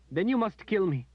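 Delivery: high, loud, tearful cry; high anguished cry. A narrator's reading. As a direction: cry it out in misery. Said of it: "she cried".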